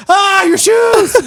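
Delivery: high, slow, loud cry of alarm; drawn-out shout. Retold or imitated. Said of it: "It was".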